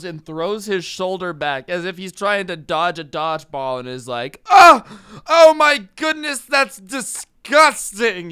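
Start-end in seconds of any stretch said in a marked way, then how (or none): 4.5-8.3 very high, loud, slow shriek of disgust; shrieking outraged old man. Retold or imitated. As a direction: recoil like a shocked grumpy old man. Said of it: "incredulous old people spirit".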